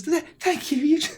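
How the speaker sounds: high mother voice, moved; admiring mother. Acted. Mother praising her child's heroic friends, admiring. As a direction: gush gratefully in a high voice.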